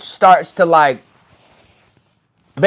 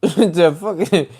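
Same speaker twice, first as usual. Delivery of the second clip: ordinary delivery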